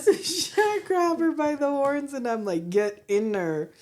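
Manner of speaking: giggling through the goat story; laughing. Real, not acted. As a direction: keep laughing as you tell it. Laughing while telling.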